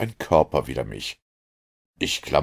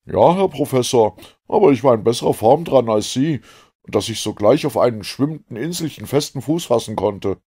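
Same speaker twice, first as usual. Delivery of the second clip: loud, higher-pitched, hearty harpooner; Ned; 6 semitones higher, 6 dB louder than usual. A narrator's reading. Ned tells the professor of his luck, hearty loud voice. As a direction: sound bold and full of vigour.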